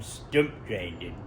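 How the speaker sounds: deep, slow, drawn-out stump grinding; odd drawn-out voice. Pretend. Vlogger repeating stump grinding in a silly deep voice. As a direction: drag out stump grinding low and slow like a movie trailer. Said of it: "Don't know why I just said it like that".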